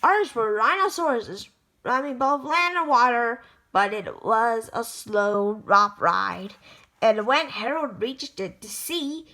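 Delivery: ordinary delivery, nothing marked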